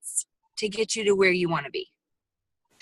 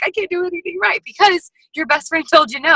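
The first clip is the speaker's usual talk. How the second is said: high, louder wail of self-doubt; doubting friend; 8 semitones higher, 5 dB louder than usual. Retold or imitated. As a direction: whine like she suddenly doubts everything. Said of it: "She's like".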